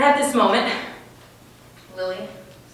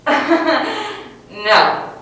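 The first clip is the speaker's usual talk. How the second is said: cold refusal; 5 semitones higher, 7 dB louder than usual. Retold or imitated. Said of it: "and said".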